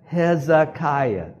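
"Hezekiah" slow and high, syllable by syllable; slow teaching pronunciation. Shown as a demonstration. Preacher has congregation say it along, "Hezekiah" in slow syllables. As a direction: spell out "Hezekiah" by sound for repeating. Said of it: "Can you say that?".

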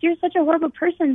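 a hint of an outraged high shout; retold yelling. Friends defending her to the group, mild mock yell. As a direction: scold them, lightly performed. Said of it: "I hear them yelling".

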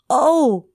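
high, dismayed oh. Acted. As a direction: cry out in dismay. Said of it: "said the gingerbread man".

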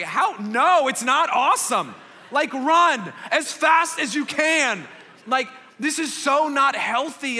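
loud, high, emphatic protest; retold exasperated shout. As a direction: shout it like you can't believe what you heard. Retelling shouting at sister.

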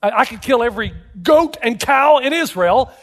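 high, exasperated-sounding protest; acted protest. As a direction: argue back at God, pitch climbing. Moses protesting to God in story.

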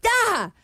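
sudden high-pitched mock cry of fright; startled yelp, retold. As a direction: re-enact a jolt of shock. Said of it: "my whole body just reacted".

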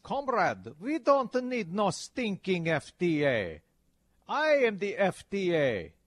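mock Russian strongman in a bad Russian accent. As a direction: boom it like a cartoon Russian dictator. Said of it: "that's my bad Russian accent".

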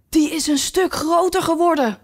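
surprised remark. Acted. Alice remarks on the queen.

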